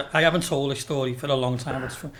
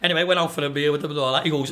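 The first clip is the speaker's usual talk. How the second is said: ordinary talk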